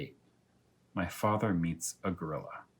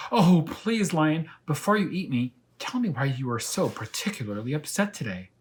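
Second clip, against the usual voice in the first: pleading; 7 semitones higher, 4 dB louder than usual. Acted. Elmer pleads with the lion.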